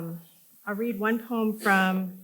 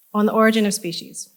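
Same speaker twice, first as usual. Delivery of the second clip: louder, quicker title announcement; projected title; 3 semitones higher, 8 dB louder, faster than usual. Real, not acted. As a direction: project it to the back of the audience. Repeats title louder for audience.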